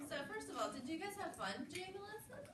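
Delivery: bright sing-song teacher question, rising high; kindergarten teacher voice. Real, not acted. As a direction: ask the class like a cheerful teacher. Teacher asking class a question.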